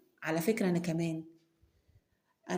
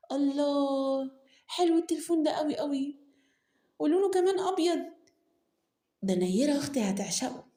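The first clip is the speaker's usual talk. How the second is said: delighted gush; 7 semitones higher than usual. Acted. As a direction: Nour thrilled by Salim's gift phone, up high. Delighted by the gift phone.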